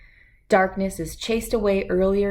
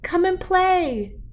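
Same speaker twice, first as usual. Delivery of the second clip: high, slow sing-song call; a child calling the fox cubs out to play; 12 semitones higher, slower than usual. Acted. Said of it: "Jasmine called".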